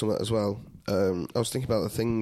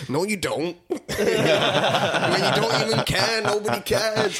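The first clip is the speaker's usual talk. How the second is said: higher, louder, indignant and hurt; upset veteran; 10 semitones higher, 4 dB louder, slower than usual. Retold or imitated. Retelling the barroom veteran who feels nobody cares, higher and louder. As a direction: play the wounded veteran protesting. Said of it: "And he went".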